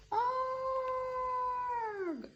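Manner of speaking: high drawn-out terrified howl; scared cry. A narrator's reading. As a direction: howl high and long in fright. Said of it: "the weasels cried".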